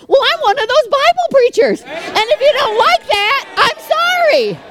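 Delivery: high-pitched, mock-apologetic comeback; defiant retort. Real, not acted. Preacher answering critics of prosperity preaching, defiant high retort.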